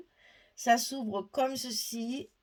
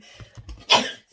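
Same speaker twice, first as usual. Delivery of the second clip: hard breathy cough; 10 dB louder than usual. Real, not acted. Coughing after the sneeze.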